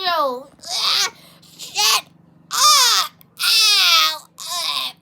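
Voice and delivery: loud, high cries of hurt; hammed-up wailing protest; pained yelling. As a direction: yell back through acted pain. Hurt, yelling back at the prankster.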